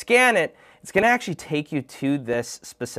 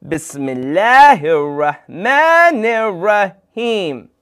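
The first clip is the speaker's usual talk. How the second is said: slow, loud, higher invocation; group-leading call; 6 semitones higher, 7 dB louder, slower than usual. Shown as a demonstration. Host leads everyone in saying Bismillah, loud and drawn out. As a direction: lead a crowd through it, drawing out each syllable. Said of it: "I need everyone to say".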